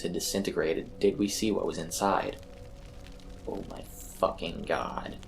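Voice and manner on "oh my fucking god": hushed, low half-whisper of horror. A narrator's reading. Ron staring at the burning field, hushed and awed. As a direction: breathe it out like a prayer. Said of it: "Ron half whispered, half prayed".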